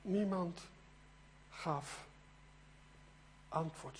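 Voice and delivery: soft, word by word; hushed, spaced out. Retold or imitated. Preacher repeats that nobody answered, soft with long pauses. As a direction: let each word drop into a long silence.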